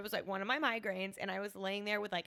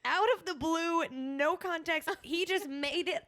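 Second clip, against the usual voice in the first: high, loud baffled rant; incredulous; 9 semitones higher, 4 dB louder than usual. Retold or imitated. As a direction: gush it in disbelief. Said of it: "And I was like".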